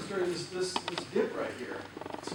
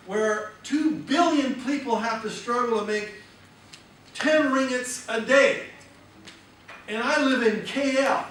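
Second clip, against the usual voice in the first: higher, louder self-talk full of gratitude; shown positive self-talk; 7 semitones higher, 7 dB louder than usual. Shown as a demonstration. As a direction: lift your voice in wonder at your blessings. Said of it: "You go".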